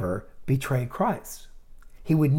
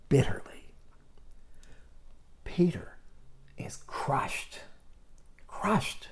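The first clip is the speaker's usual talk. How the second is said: hushed whisper; 2 semitones higher, 9 dB quieter, slower than usual. Real, not acted. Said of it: "preacher whispers Peter's grief".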